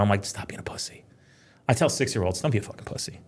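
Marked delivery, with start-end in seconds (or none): 0.2-1.0 whispered telling-off, barely any voice; hushed scolding. Retold or imitated. Dad to scared kids before a ride, whispered scolding. Father hissing at reluctant kids. 2.4-3.2 hushed scolding. Retold or imitated. Dad telling six-year-olds off, hushed scolding.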